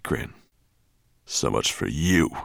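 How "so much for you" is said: low, satisfied dragon grunt; gruff dismissal. A narrator's reading. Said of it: "he grunted".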